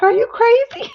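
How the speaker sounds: high, loud incredulous squeal; mock outrage at the shoes; quoting the film scene, squealing in disbelief; shocked movie character. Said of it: "and he's like".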